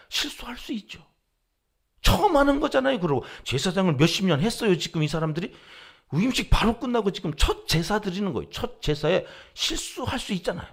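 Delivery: loud, high pleading protest to God; heated protest. Retold or imitated. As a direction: argue with God, voice rising.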